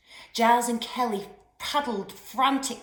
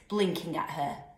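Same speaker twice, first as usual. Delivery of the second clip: ordinary delivery, nothing marked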